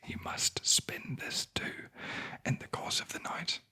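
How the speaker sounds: usual speaking voice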